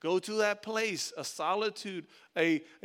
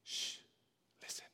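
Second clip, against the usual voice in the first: gentle hush from God; 2 semitones lower, 4 dB quieter than usual. Retold or imitated. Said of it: "And then God's like".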